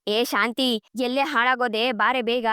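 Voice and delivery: ordinary delivery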